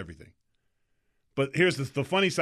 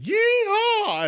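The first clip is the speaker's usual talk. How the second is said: whooping yeehaw, very high and loud; Dukes of Hazzard yeehaw; 5 dB louder than usual. Retold or imitated. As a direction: let out a wild country whoop. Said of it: "does a Dukes of Hazzard".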